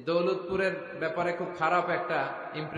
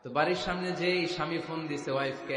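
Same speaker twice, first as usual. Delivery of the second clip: normal talking voice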